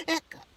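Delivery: sudden high squeaky hiccup. Acted. Character hiccups mid-sentence.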